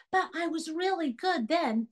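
put-on high-pitched kid making excuses; pleading child. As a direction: protest like a child to a parent. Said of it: "Any parent here is gonna get that".

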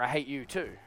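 low, soft bitter murmur; inner hatred. Retold or imitated. Pastor confessing his hateful heart, soft low bitter murmur.

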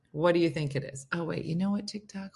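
normal talking voice

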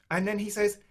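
ordinary delivery

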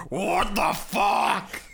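slow deity voice. Retold or imitated. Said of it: "looks down at Seto Kaiba like".

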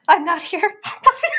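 high, rising squeak of denial; hiding in mock panic. Aubrey hiding behind a fence, high comic denial. Hiding character denies being there.

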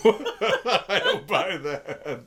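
slow words broken up by laughter; laughing disbelief. Real, not acted. Laughing at dad's movie verdict.

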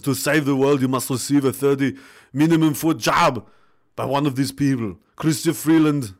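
mock Austrian accent, like Arnold Schwarzenegger. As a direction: read it out as an Arnold impression, Austrian accent and all. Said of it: "say his name in the Arnold accent".

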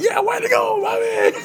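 very high, whooping cheer; mock cheering shout. Couple cheering Bob on as they watch TV, mock whooping shout. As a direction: holler congratulations in a squeezed high voice. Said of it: "You know, like".

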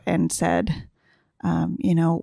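ordinary talk, nothing marked

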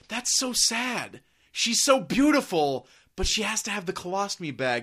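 slow, cooing fake sympathy; mock pity. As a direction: imitate a gushing onlooker, drawing it out. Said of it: "here's the outcome of what you've done here".

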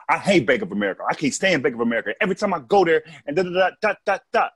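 punchy, rhythmic comic rant climbing in pitch; driving sing-song beat, rising toward the end; old stage cadence. Shown as a demonstration. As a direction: imitate a hyped-up comic building line by line. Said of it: "I used to talk in a cadence".

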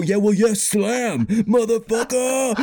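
high, drawn-out sing-song boast; mock triumphant comeback. Comedian imagining his winning retort in the argument, mock triumphant. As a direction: crow it like a knockout punchline, stretching the last word.

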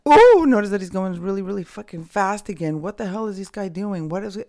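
loud high "Oh", then staying high-pitched; retold alarm. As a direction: break out of calm into comic panic.

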